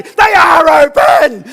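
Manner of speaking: very loud, slow, high yell; panicked shout. Retold or imitated. Abseiler yelling back up in panic, very loud and slow. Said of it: "they'd say".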